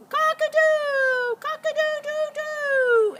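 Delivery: very high, loud, slow drawn-out crow; rooster crow. A narrator's reading. Rooster yelling the coyote alarm to the chickens, loud high crow. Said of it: "the rooster yelled".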